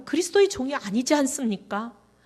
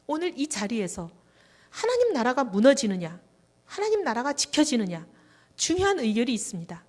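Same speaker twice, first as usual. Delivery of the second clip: prayer building from plain to loud and high; a retold prayer swelling into loud fervour; fervent retold prayer; 3 semitones higher, 4 dB louder than usual. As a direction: plead with God, rising to a near shout. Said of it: "이렇게 기도하고 있는데".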